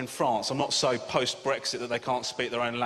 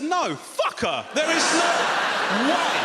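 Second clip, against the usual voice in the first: slow, loud shouted refusal; mock outraged refusal; 13 semitones higher, 6 dB louder, slower than usual. Comic refusing a radio offer for his mum, slow loud shout. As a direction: bellow it out slowly, hammed-up outrage.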